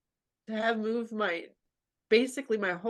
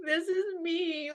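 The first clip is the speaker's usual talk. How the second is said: high pleading; 10 semitones higher, slower than usual. Retold or imitated. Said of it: "being like".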